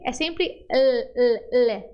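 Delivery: slow, push the L hard in É sempre L; forceful L. Shown as a demonstration. Teacher drilling a strong Spanish L. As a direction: give each L real force. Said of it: "força, bota força".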